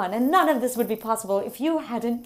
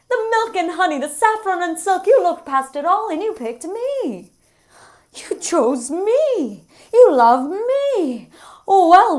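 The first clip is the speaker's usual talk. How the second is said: higher and louder, mock delight building; sarcastic gushing; 5 semitones higher, 5 dB louder than usual. To the man who chose her, sarcastic mock gushing. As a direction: oversell the gratitude until it drips sarcasm.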